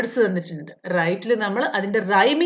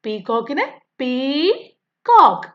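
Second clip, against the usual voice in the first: Peacock said slowly as പീ and coke, apart; syllable demo; 2 semitones higher, slower than usual. Shown as a demonstration. Teacher splitting the word for pupils with closed eyes, slow syllables. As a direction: split peacock into two clear beats. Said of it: "നിങ്ങൾ teacher പറയുമ്പോ കണ്ണടച്ചിരിക്കണം".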